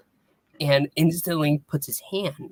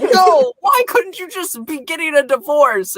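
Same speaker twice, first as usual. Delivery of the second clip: put-on much higher, louder whining kid; whiny kid protest; 4 dB louder than usual. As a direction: wail it like a crushed little boy. Kid protests parents not divorcing.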